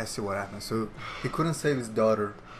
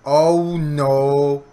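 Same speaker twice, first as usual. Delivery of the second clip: scared outcry; 3 semitones higher, 9 dB louder than usual. Real, not acted. Scared by game scene.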